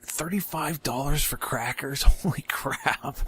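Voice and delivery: high-pitched disbelief at a price; incredulous. Real, not acted. Player balking at thirty-five dollars for crackers, incredulous.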